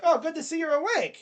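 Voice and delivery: a light, high, chipper bedside voice; cheery doctor. Acted. Doctor greets a patient waking from a coma, lightly voiced chipper. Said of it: "the doctor's like".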